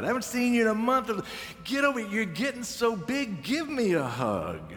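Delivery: high, delighted grandma call; grandmother's greeting. Retold or imitated. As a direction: call them in like a doting grandmother. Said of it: "She talked like that".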